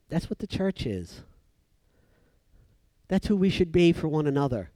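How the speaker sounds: normal talking voice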